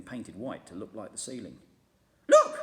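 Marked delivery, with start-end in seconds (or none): 2.3-2.6 very high, loud scream. A narrator's reading. Missus Twit screaming at the upside-down room. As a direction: shriek in shock. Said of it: "screamed Missus Twit".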